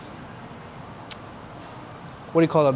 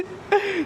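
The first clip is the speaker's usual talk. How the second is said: high laugh; 4 dB quieter than usual. Real, not acted. Laughing at the memory.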